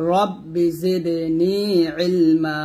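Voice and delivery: রব্বি জিদনি ইলমা sung out slowly with a one-beat lengthening; slow Quranic recitation of রব্বি জিদনি ইলমা; one beat stretch. Shown as a demonstration. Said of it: "এক লিপ টান দিবেন এরকম".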